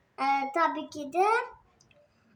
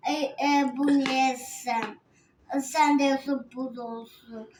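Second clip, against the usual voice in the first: ordinary delivery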